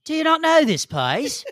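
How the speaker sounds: slow, drawn-out mock British accent; witchy South London accent. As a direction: ham up a high South London lilt. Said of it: "they all talk like witches".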